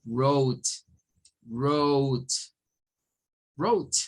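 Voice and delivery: "wrote" as "rowt", silent w; "wrote" starting straight on English r; English pronunciation demo. Shown as a demonstration. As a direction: model "wrote" slowly with a gliding long O. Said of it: "Lo vamos a leer como".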